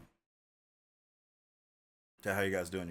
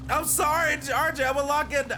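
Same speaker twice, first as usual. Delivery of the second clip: high-pitched apology; 12 semitones higher than usual. Pretend. Apologizing to viewer RJ.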